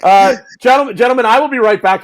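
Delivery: loud, high butler-like announcement; mock formal announcement. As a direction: announce it grandly, half joking. Excusing himself dramatically.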